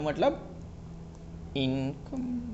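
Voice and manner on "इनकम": "इनकम" split into a stretched "in", then "kam"; drawn out syllables. Real, not acted. Teacher prompting the class for the meaning, "इनकम" drawn out in two parts. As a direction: stretch "इनकम" softly, leaving room for others to join.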